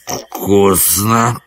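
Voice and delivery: wood spirit's rasping reply; hoarse, drawn-out creature voice; hoarse croak. A narrator's reading. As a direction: croak it slowly from the bushes. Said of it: "прохрипело в кустах".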